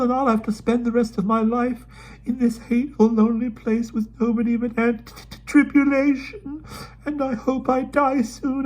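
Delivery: put-on high, tearful, breaking girl's wail; sobbing. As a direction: burst into tears over leaving. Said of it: "she sobbed".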